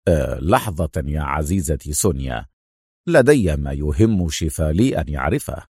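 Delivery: low, unruffled voice; low and composed; calm. Acted. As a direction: say it coolly, pitch dropped. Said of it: "قال أدهم في هدوء".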